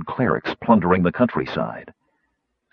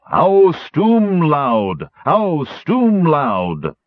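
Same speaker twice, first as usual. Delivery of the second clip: very slow, higher, louder drawn-out hoot; hooting tree men; 9 semitones higher, 5 dB louder, much slower than usual. A narrator's reading. Tree men hooting their war cry at the tower, slow chant. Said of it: "They began to hoot".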